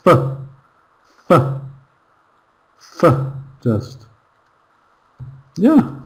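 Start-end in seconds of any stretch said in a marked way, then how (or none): 0.1-3.3 "F" as a tiny weak fuh; reduced for. Shown as a demonstration. Teacher isolating the reduced word, "f" cut very short. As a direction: squeeze for down to a bare "f" and short neutral vowel. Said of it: "It's just squeezed down to".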